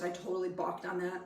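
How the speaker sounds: ordinary talk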